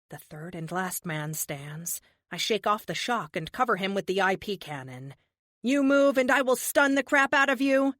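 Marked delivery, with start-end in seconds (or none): 5.6-8.0 raised, high warning voice; loud threat. Acted. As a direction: bark the threat at him. Heroine threatens the last man.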